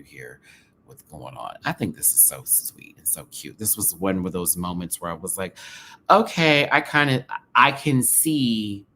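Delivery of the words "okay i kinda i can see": excited reaction. Retold or imitated. Reacting loudly to the scene.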